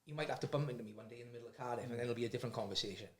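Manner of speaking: soft, low, quick retold threat; quiet warning. Ex-gang member warning laughing kid, quietly menacing. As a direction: drop your voice to warn the kid.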